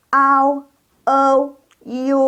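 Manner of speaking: Au, O, U as ao, ou, iu, high and level; model diphthongs. Shown as a demonstration. Teacher models the third group, high sustained glides. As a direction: draw out each glide on a high pitch. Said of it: "Yuk, bareng".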